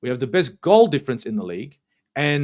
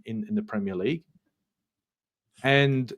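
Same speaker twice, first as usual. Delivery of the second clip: ordinary talk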